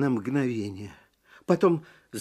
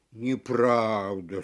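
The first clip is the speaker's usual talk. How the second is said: gentle tiger; 7 semitones lower, slower than usual. Acted. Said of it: "сказал тигр".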